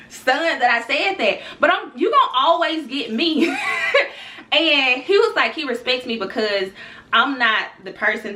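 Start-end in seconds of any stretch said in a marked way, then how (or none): 3.6-4.5 high laugh. Real, not acted. Laughing at her own story.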